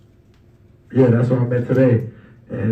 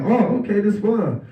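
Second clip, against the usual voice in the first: much higher, delighted oh okay; surprised delight; 8 semitones higher, slower than usual. Retold or imitated. Umpire delighted the players cheer back, high surprised. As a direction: pleasantly caught off guard.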